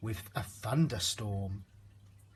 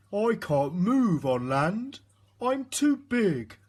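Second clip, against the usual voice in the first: slow, louder, despairing whale; a stranded giant groaning; moaning whale; 7 semitones higher, 5 dB louder than usual. A narrator's reading. Beached whale moans to the snail, slow and loud. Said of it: "moaned the whale".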